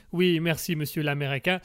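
ordinary talk, nothing marked

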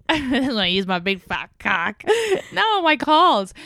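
laughing through a slow joke, drawn out. Real, not acted. Joking back to her cohost, laughing through it. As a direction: giggle the words out slowly.

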